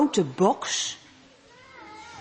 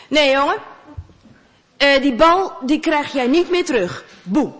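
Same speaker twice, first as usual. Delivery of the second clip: gruff refusal; 4 semitones higher, 5 dB louder than usual. Acted. Neighbour refuses, loud.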